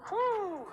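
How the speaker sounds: high breathy whew of relief; exhausted whew. Real, not acted. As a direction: blow out a tired whew. Exhales after hard set.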